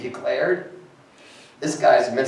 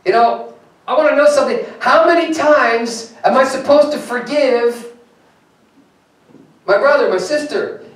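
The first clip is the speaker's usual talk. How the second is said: higher, louder, eager questioning Peter; Peter asking Jesus; 11 semitones higher, 7 dB louder than usual. Acted. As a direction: push the question up like a puzzled disciple. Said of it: "and he says to Jesus".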